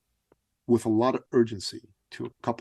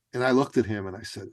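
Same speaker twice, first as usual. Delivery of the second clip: ordinary delivery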